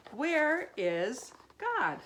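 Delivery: high, loud and slow; shouted. A narrator's reading. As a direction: call the question out slowly. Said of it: "they shouted".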